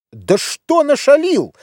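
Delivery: high, drawn-out demanding question; interrogating. A narrator's reading. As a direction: interrogate like an exasperated father, pitch leaping up. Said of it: "допрашивал отец". (допытываясь, высоко и протяжно)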